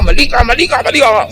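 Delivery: high mock angry "mali ka" repeated; a hammed-up angry accusation, pitched high. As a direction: play the furious person pointing the finger. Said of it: "Galit na galit siya".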